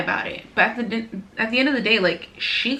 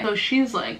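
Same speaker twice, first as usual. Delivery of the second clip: usual speaking voice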